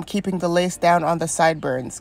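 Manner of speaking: ordinary talk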